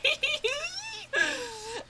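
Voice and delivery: high, breathy laughing fit; helpless laugh. Real, not acted. Gamer laughing at the game, helpless laughter.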